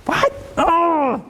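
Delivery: high, exaggerated outraged gasp; mock shocked parent. Parent overreacting to a teen's words, mock outrage. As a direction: blurt it in overdone disbelief. Parent repeating teen, mocking.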